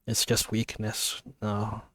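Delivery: soft, teary aside after the reading; quietly pulling herself together; softly admitting she is tearing up. Real, not acted. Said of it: "Not because I'm crying".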